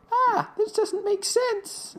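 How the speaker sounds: very high, slow, panicked voice; panicked squeak. Retold or imitated. Eugene panicking among the tombstones, high squeak. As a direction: let it squeak out in alarm.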